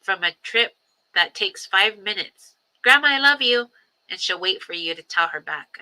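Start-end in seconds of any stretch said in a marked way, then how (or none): 2.8-3.7 put-on high, louder childlike voice; imitating little granddaughter. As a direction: mimic a small girl calling out from the back seat.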